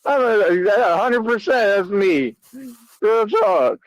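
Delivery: hammed-up high villain voice; mock Frieza voice. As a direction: lord it over them like a cartoon tyrant. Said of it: "gonna start talking like that to y'all".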